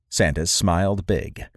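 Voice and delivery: ordinary delivery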